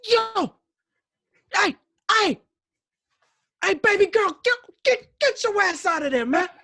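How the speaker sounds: very high, loud shout across a distance; yelling. Retold or imitated. Shouting at a little girl in the pool, high loud yell. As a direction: holler at her to get out. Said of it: "I yelled at her".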